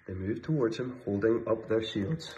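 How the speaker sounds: plain reading voice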